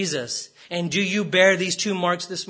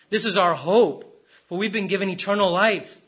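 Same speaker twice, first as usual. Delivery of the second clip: usual speaking voice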